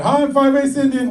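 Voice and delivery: put-on high, bright kid voice, playfully; a kid proudly introducing himself; mock child voice. Comic mimics a child saying hi, high mock kid voice.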